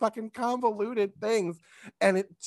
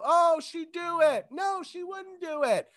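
audience yelling out; 10 semitones higher than usual. Retold or imitated. Audience shouting suggestions to contestants, high mock calling. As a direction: imitate crowd members calling out, pitched way up. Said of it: "Let 'em fucking yell out".

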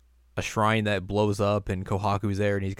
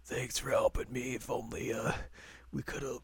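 weak dying voice; 3 semitones higher, 9 dB quieter than usual. Retold or imitated. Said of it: "this dude who's like".

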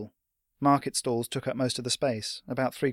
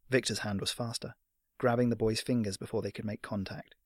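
ordinary delivery

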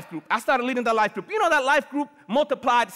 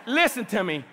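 ordinary talk

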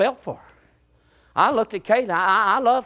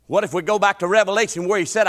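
ordinary talk, nothing marked

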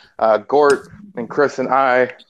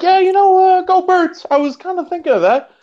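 high mock friend voice. Retelling the friend's reply, high mock voice. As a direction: pitch it way up to play the friend.

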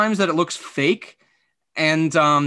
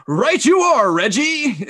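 high, loud broadcaster reply to a co-host; announcer voice; 8 semitones higher, 4 dB louder, slower than usual. Retold or imitated. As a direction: answer the co-host like you're on air. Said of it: "you're doing this announcer voice".

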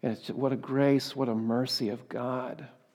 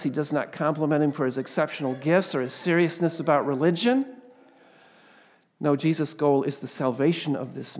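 louder, higher sermon push; raised pulpit delivery; preaching; 3 semitones higher, 6 dB louder than usual. Acted. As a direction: build the preaching energy. Rising sermon intensity.

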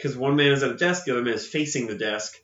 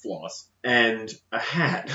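usual speaking voice

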